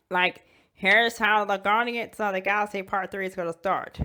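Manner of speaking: ordinary delivery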